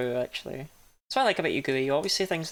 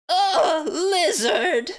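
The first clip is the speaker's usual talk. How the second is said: much higher, louder laughing shriek of "lizard"; final lizard scream; 11 semitones higher, 6 dB louder, much slower than usual. Retold or imitated. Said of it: "you get one more final".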